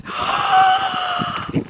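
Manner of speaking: sharp very high real gasp; a big gasp of disbelief; shocked gasp. Real, not acted. Finding the huge asparagus, a real shocked gasp. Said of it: "that was a bit overwhelming".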